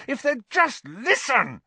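high, drawn-out frustrated outburst; furious. A narrator's reading. Boy not being listened to, furious. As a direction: burst out furiously, stretching the words. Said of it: "Harry said furiously".

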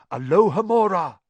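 ordinary delivery

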